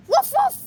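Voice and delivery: very high, loud yaps; high wolf woofs. Pretend. Mister Wolf showing his bark, high and loud. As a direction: bark like a squeaky little wolf. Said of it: "I'm Mister Wolf".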